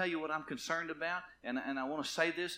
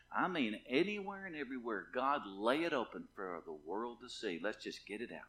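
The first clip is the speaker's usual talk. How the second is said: ordinary talk, nothing marked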